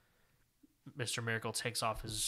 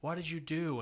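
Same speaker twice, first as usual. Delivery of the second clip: high, louder panicked cries over a fallen friend; freaking out; 6 semitones higher, 4 dB louder than usual. Retold or imitated. Scott finds Orion dead and cries out in panic, higher and louder. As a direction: retell it as a man losing it over a body. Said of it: "he's freaking out".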